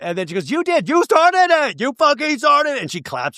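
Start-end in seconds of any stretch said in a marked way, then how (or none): 0.5-2.8 high, loud mimicked outburst; hammed-up explosive yell. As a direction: blow up in a mock screaming accusation. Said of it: "I love the explosiveness, the explosive yelling".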